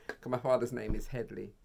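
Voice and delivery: ordinary talk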